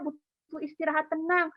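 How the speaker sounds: ordinary talk, nothing marked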